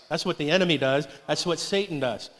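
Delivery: usual speaking voice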